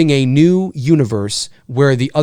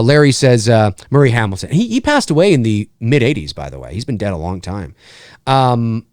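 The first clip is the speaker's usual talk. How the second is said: ordinary talk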